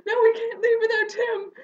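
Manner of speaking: high, loud cry, half laughing, half crying; panicked protest. Retold or imitated. Insisting to Wesley they save Tim, desperate. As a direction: refuse desperately, voice cracking. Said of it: "I was just like".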